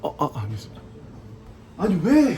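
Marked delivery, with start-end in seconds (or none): 1.8-2.4 put-on belligerent drunk. 취한 채 억울해하는 촌극.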